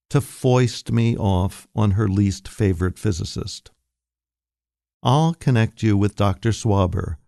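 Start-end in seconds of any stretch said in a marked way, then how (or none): none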